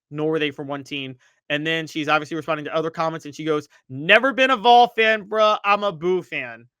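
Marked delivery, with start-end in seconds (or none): 3.9-6.7 louder, higher punch tailing down on the last words; exclamatory quote. Retold or imitated. Host reading the mom's fan comment aloud, exclamatory. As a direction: read her post out with exclamation marks. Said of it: "Exclamation point, exclamation point, exclamation point".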